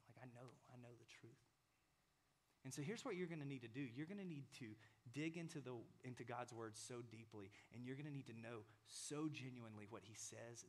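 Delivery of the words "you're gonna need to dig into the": ordinary delivery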